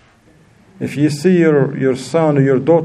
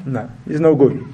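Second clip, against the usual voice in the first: normal talking voice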